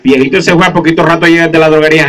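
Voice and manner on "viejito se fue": ordinary talk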